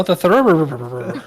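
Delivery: "Thurber" stretched into a long slow burble; burbling nonsense noise. Pretend. Player mimicking a friend's silly word, "Thurber" burbled at length. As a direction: draw "Thurber" out with many rolling ur sounds. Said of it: "You're just making noises".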